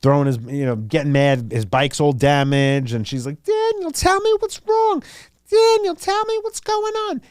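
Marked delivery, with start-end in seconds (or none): 3.4-7.2 much higher put-on mom voice, pleading; mocking the mom. Mom begging her son Daniel to talk, in a high mocking voice. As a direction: squeak it out like a fretting mother.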